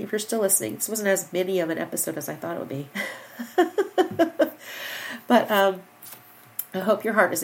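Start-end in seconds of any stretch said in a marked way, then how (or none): 2.9-5.2 high burst of laughter; laughing. Real, not acted. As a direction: laugh at your own long episode. Laughs at long episode.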